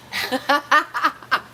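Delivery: bright burst of laughter; delighted laugh. Real, not acted. Laughs at guest's effort.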